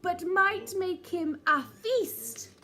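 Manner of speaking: hammed-up alarm; dramatic worried outburst; mock fright. A narrator's reading. Narrator frets dramatically.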